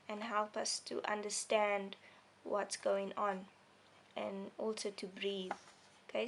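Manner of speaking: normal talking voice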